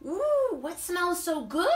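a friend drawn in by the smell; curious sniff. Acted. Brody sniffing the soup.

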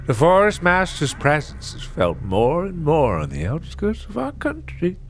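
high creaky granny telling of a monster; old woman voice. Acted. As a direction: spin a spooky tale like a village crone. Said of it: "Tamara, the old woman".

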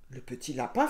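plain reading voice

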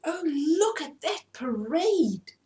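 slow delighted high cry of wonder; excited cry. A narrator's reading. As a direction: gasp at the sight, lingering. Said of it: "cried three women".

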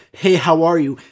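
loud, forceful mimicked greeting; imitating an aggressive teller. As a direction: throw the greeting out hard. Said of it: "That was the most aggressive".

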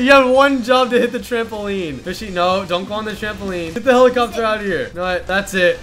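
normal talking voice

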